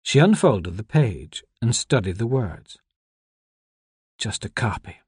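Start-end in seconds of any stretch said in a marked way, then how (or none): 4.2-5.0 soft, hushed near-whisper. A narrator's reading. Anna studying the unfolded page, hushed whisper. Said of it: "she whispered".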